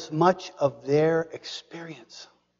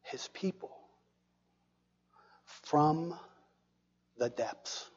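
much softer, breathy and falling; hushed whisper; 3 semitones lower, 13 dB quieter than usual. Acted. Preacher whispering the psalm's phrase to the congregation, hushed. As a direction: draw them in with a whisper.

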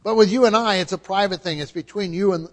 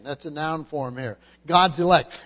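normal talking voice

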